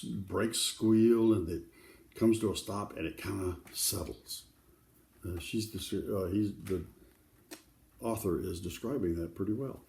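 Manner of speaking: plain reading voice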